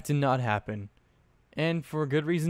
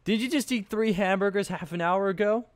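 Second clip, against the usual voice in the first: put-on high, incredulous girl's voice; skeptical question; 9 semitones higher than usual. A narrator's reading. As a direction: raise an eyebrow while asking. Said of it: "questioned with a raised eyebrow".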